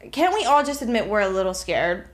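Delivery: ordinary talk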